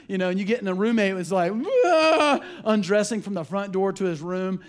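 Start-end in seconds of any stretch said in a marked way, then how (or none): 1.9-2.4 mock exclamation. Imitating messy roommate.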